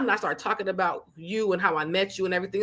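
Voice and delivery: ordinary talk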